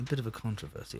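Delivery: very soft, hushed British narrator; David Attenborough. Acted. Co-host retrying the impression, very soft British narrator. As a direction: murmur it like a documentary voiceover. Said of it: "I just gotta do David Attenborough".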